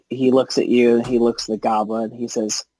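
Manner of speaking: usual speaking voice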